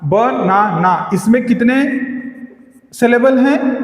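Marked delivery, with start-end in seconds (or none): 0.0-1.1 बनाना split slowly as ब, ना, ना; three separate beats in बनाना: ब, ना, ना; syllable by syllable. Shown as a demonstration. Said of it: "इसमें तीन सिलेबल हैं।".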